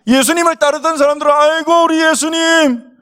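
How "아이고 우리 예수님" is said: high, drawn-out pretend wail; mock wailing lament. Followers mourning Jesus on the cross, acted-out wailing. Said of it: "이러면서 막 울었잖아요".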